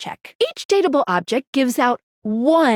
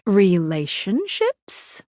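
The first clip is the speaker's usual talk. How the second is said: very slow, lower and stretched; drawn-out musing; 4 semitones lower, much slower than usual. Acted. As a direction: stretch it out syllable by syllable. Teasing game menu reply.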